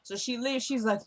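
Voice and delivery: normal talking voice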